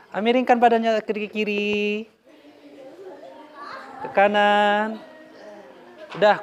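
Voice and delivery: ordinary talk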